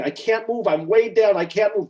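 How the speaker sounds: high, loud, panicked mock scream; retold screaming. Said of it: "she starts screaming".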